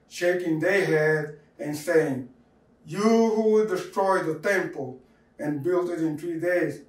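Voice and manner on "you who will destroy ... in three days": plain reading voice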